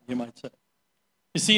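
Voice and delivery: usual speaking voice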